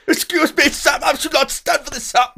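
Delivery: high, loud mock outrage, Victorian English; hammed-up affronted ye olde English toff; Victorian English gentleman. Customer ordered off the premises, indignant Victorian English gentleman. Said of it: "a ye olde English Victorian-y style".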